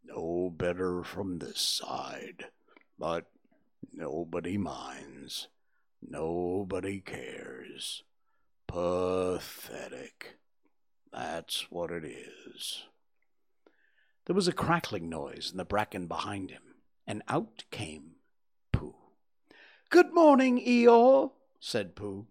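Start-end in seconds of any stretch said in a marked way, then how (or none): 0.0-12.8 very low, slow and mournful Eeyore; gloomy donkey. A narrator's reading. Eeyore mopes by the stream. 19.9-21.3 much higher and louder bright little bear; cheerful Pooh. A narrator's reading. Pooh greets Eeyore.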